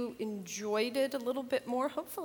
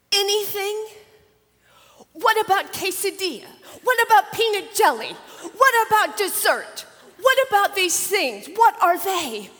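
put-on high, loud childish rattle of questions; indignant little girl; 10 semitones higher, 11 dB louder than usual. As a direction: fire off the list like a sassy kid. Tara lists foods she'd eat.

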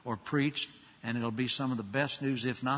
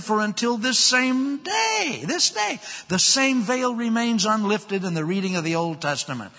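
preaching at full force; 5 semitones higher, 7 dB louder than usual. Acted. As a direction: preach it out to the back row. Preaching the passage.

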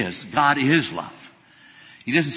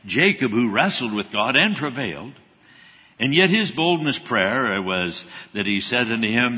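ordinary delivery

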